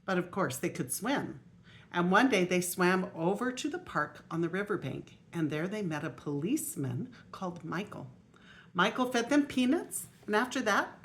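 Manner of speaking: lively storybook reading aloud; grandma reading a picture book aloud; reading to children. Acted. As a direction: read it out to the little grandchildren.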